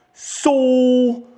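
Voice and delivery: hammed-up imitation of a bodybuilder sergeant opening his talk; Sergeant Chop impression. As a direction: boom out "So" like a huge jacked sergeant. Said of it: "That's exactly how he sounded".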